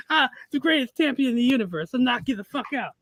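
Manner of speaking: high boasting dad impression; Tyson-style boast. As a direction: brag like a champ boxer. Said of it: "continuing Tyson bit".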